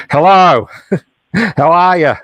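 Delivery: bad Australian accent, high and loud; a weak try at Australian; mock Australian greeting. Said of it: "This is the best I can do".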